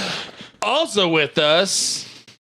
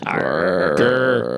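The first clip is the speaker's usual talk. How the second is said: lightly growled mummy groan; a hint of a dusty mummy rasp; 10 semitones lower than usual. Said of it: "I sounded like the mummy".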